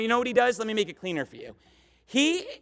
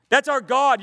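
That's punched out louder, rising in fervor; louder, emphatic; 4 semitones higher, 6 dB louder than usual. Real, not acted. Preacher to the study, repeating with more force, louder. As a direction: proclaim That's with full force.

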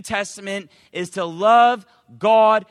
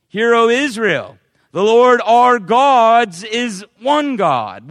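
loud, solemn reading of holy words; loud preacher reading scripture; 9 dB louder, much slower than usual. Acted. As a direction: proclaim the verse to the congregation. Reading scripture aloud in sermon.

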